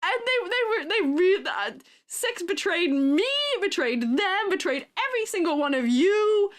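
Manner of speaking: high, impassioned lament of betrayal; high, worked-up comic indignation; theatrical outrage. Real, not acted. Rants about betrayal of her critters.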